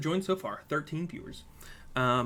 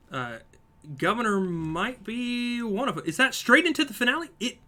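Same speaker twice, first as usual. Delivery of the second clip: live play-by-play; 5 semitones higher, 4 dB louder than usual. Real, not acted. Streamer calling a marble race for viewers, lively play-by-play. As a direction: race commentary, rising with the action.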